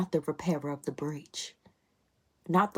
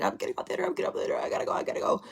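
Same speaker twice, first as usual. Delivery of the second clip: mock panic; much faster than usual. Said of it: "I kinda was just like".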